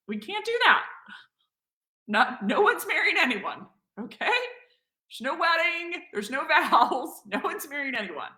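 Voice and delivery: high, laughing no-no-no protest; high excited mock refusal. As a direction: squeal it out half laughing, waving the idea away. Emphatic refusal of marriage offer, laughing.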